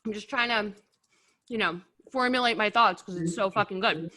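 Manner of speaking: talking through a mouthful of wings; chewing while speaking; mouth full. Real, not acted. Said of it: "Talking with my mouth full".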